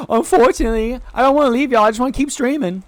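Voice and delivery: high-pitched exaggerated whine; gasp, then wail it high in protest; mock lament. Streamer not wanting to leave chat for errands, mock lament.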